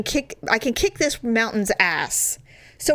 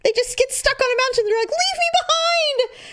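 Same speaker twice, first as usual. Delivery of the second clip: hammed-up dread; 13 semitones higher than usual. Retold dramatic fear of dying.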